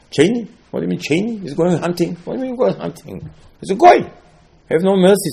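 high disbelieving exclamation; high and loud, what do you mean Cheney; incredulous. Acted. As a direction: scoff at the very idea. Incredulous about Cheney hunting.

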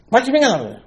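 high indignant complaint; complaining people. Retold or imitated. The people complaining to Moshe, high and indignant. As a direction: whine it up high in protest.

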